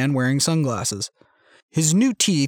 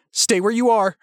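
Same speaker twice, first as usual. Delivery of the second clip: tense warning; 7 semitones higher than usual. A narrator's reading. Armed standoff, warning advancing man.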